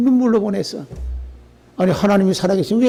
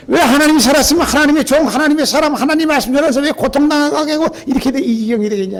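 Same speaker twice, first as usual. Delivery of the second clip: higher, louder impassioned sermon; fiery preaching; 9 semitones higher, 4 dB louder than usual. Acted. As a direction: drive the point home to the congregation. Preaching at full force.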